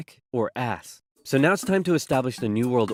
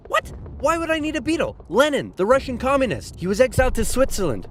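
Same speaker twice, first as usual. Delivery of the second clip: high-pitched, exasperated correcting; 9 semitones higher than usual. Acted. Correcting someone who misheard [name] as a Beatle, high and exasperated. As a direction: spell it out, losing patience.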